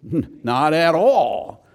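slow, loud, drawn-out denial; Paul's emphatic, emotional no. Shown as a demonstration. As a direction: stretch it out and hit it hard. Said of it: "that emphatic, emotional".

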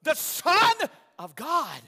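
high, loud, thrilled announcement; preacher acting out an overjoyed Mary; Mary voiced excitedly. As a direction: exclaim it like Mary bursting with news. Preacher voicing Mary's excited announcement.

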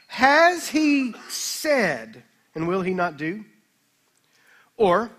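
slow, deliberate Bible reading with long pauses; scripture reading. A narrator's reading. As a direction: read each phrase out to the congregation, weighty and measured. Reads verse from the screen.

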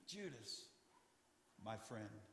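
barely above a breath, full of love; hushed, tender repeat to the betrayer. Shown as a demonstration. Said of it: "would speak to them like this".

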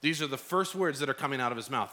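ordinary talk

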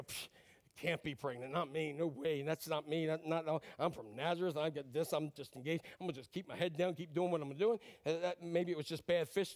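mock doubter. Voicing the doubting believer.

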